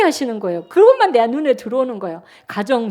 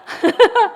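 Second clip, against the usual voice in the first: giggling laugh, pitched up; high burst of laughter; 10 semitones higher, 4 dB louder than usual. Real, not acted. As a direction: laugh out at the memory. Laughing at her own retort.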